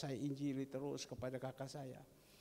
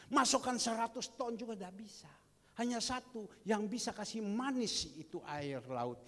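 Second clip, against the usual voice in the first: louder, higher fervent preaching; preaching at full force; 3 semitones higher, 6 dB louder than usual. Acted. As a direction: drive the image home. (khotbah berapi-api)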